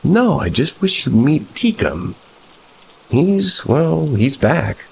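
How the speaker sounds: put-on high, eerie girlish voice; eerie girlfriend. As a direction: announce the goblin's return like a delighted child. Girlfriend speaking eerily of Teekum.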